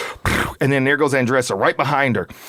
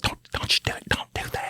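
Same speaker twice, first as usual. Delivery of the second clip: hushed, voiceless scolding whisper; imitated whispered warning; 9 dB quieter than usual. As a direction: act out a secret whispered telling-off. Wife's secret warning acted out.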